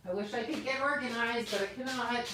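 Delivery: distant aside while hunting for something; away from mic. Real, not acted. Streamer off set looking for a gift, distant voice.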